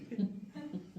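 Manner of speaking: brief high chuckle; short laugh. Real, not acted. Joking about being stuck with the party, short high laugh. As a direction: laugh at your own joke. Laughs at his own joke.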